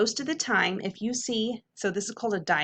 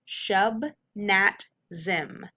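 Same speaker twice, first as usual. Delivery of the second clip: shub, nat, zim as separate beats; syllable by syllable; much slower than usual. Shown as a demonstration. Teacher sounding out the word for students, syllable by syllable. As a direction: give SHUB, NAT and ZIM each its own beat with gaps. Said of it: "Whole word".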